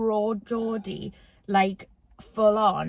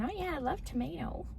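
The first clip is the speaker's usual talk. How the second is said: a light, soft put-on American accent with tomato as tuh-MAY-doh; 6 semitones higher, 10 dB quieter than usual. Imitating friend Claire from Chicago, American accent. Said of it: "She was very American".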